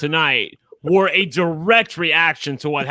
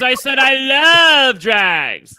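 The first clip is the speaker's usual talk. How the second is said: slow, exaggerated sarcastic insistence; drawn-out, mock-earnest claim; 8 semitones higher, 4 dB louder, much slower than usual. Real, not acted. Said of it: "Which is obviously sarcastic".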